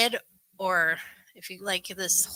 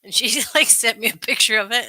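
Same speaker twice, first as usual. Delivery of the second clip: ordinary talk, nothing marked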